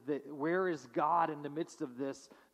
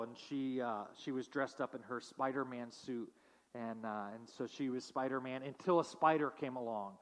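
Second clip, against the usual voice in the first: ordinary talk, nothing marked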